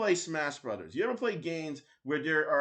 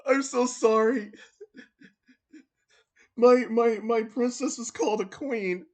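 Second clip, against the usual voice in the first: higher, louder hammed-up weeping; mock grief; 7 semitones higher, 3 dB louder than usual. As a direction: fake sobbing dramatics. Mock grief over princess.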